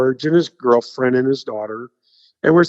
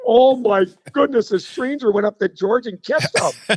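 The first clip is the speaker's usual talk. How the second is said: high-pitched scandalised amazement; mock shocked onlookers; 7 semitones higher than usual. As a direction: imitate gawkers in disbelief, pitched up.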